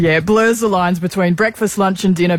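slow, measured live read. Acted. Co-host reading the cafe sponsor ad, slow and smooth. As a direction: sell the cafe like a radio ad.

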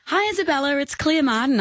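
high, put-on caller voice; Claire Martin voice. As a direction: pretend HR staffer on the phone. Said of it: "I knew she'd recognise your voice".

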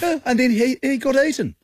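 put-on high New Zealand accent; Kiwi accent. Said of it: "You put on a Kiwi accent for that".

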